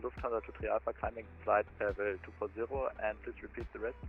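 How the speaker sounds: ordinary talk, nothing marked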